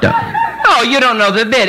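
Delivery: ordinary talk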